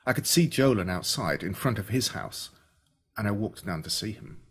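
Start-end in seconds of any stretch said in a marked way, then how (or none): none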